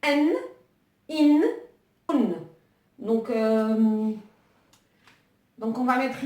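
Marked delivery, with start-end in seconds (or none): 0.0-2.3 n, in, un said as separate sounds; n, in, un clearly apart; nunation sounds. Shown as a demonstration. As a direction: sound out each nunation ending. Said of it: "c'est les sons".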